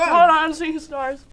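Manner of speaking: dazed. Real, not acted. Overwhelmed by spice.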